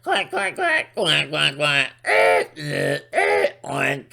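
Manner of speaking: high mocking quacks and oink; silly high duck and pig sounds; animal noises. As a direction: honk like a farm animal to mock them.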